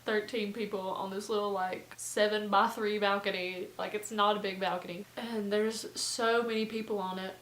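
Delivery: ordinary delivery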